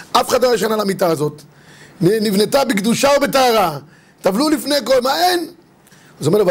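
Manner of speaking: ordinary talk, nothing marked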